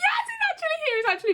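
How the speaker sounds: excited squeal. Retold or imitated. Fan recalling her idol's surprise entrance, giddy high squeal. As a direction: gush it like a thrilled fan. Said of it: "I get so excited".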